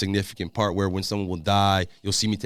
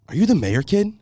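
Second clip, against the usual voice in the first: higher and louder, thrilled surprise; excited recognition; 10 semitones higher, 6 dB louder than usual. Retold or imitated. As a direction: she just realised who he is. Wardrobe woman recognises the mayor kid.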